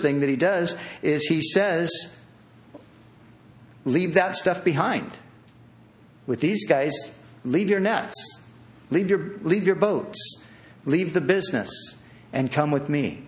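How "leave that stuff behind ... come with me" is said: normal talking voice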